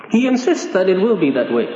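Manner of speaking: ordinary talk, nothing marked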